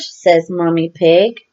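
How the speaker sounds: ordinary delivery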